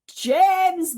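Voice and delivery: high, very loud bawled name. Acted. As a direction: bawl the name for help. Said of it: "bawled the centipede".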